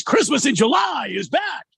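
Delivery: hammed-up announcer blast, pitched high; mock over-the-top radio puke voice; puking. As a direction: overblown shouted ad read. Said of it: "If you talk like this, it's puking".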